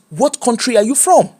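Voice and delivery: usual speaking voice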